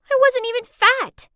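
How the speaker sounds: high, loud, offended protest; indignant outburst. Acted. Teen called chubby by mom, indignant outburst. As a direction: cry out in wounded outrage.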